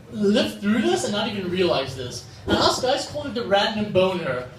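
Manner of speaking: normal talking voice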